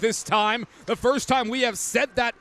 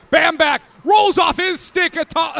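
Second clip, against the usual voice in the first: excited call; 5 semitones higher, 5 dB louder than usual. Real, not acted. Goal chance call.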